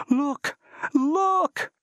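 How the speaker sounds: frantic, pointing and begging her to see; panicked cry. A narrator's reading. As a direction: pitch shooting up in panic on each Look. Man sees figure on wing.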